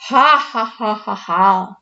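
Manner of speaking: booming ghost cackle; spooky cavernous ghost laugh; ghostly laugh. A narrator's reading. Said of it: "diz com voz cavernosa".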